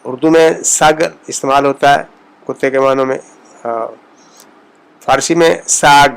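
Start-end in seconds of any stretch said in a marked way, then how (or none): 5.7-6.1 louder ساگ with a wide open aa; open Persian a. Shown as a demonstration. Teacher contrasting Urdu سگ with Persian. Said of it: "منہ کو تھوڑا کھول کر".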